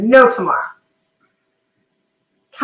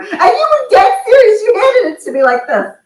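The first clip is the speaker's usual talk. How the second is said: laughing disbelief; 9 semitones higher than usual. Real, not acted. Retelling husband's form answer.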